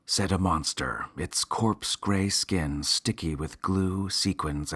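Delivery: usual speaking voice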